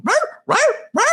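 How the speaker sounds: high, yappy mock barking; high-pitched puppy yaps, played for laughs. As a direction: imitate an excited pup with sharp little yelps. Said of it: "banged in a pup mask going".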